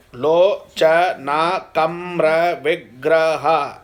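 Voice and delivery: "Lo, cha, na, kam, ra, vigraha" counted out in separate syllables. Shown as a demonstration. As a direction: break Lo, cha, na apart, one beat each. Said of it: "Eight plus eight".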